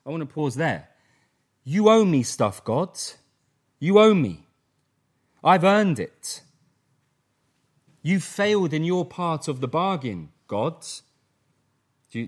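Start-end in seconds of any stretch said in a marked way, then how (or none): 1.6-10.3 loud, indignant demand; demanding son. Retold or imitated. Son confronting God, loud and indignant demand. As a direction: insist angrily you are owed. Preacher voices son's demand.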